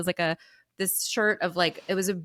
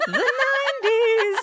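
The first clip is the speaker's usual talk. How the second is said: mock exclamation; 5 dB louder than usual. Real, not acted. Exclaiming the era with a laugh.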